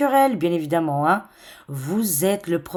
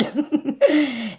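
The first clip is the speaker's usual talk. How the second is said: high burst of laughter; 7 semitones higher than usual. Real, not acted. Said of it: "on rigole".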